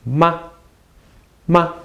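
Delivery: "ma" said short and light; brief toneless "ma", repeated for learners; neutral tone. Shown as a demonstration. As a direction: clip "ma" off with no tone contour. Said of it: "This is called the neutral tone".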